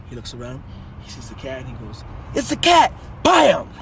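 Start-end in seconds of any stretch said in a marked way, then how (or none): 2.4-3.7 loud, high, abrupt yell; shouted punchline. Acted. Last man gives himself away to the dad, shouting. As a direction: burst out yelling.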